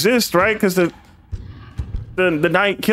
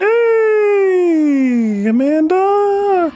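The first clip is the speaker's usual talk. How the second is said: calling out; 12 semitones higher, much slower than usual. Real, not acted. Calling out to a character.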